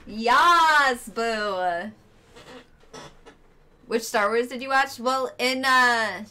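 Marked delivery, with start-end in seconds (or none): 0.1-1.9 triumphant shout. Real, not acted. Finally beats the challenge.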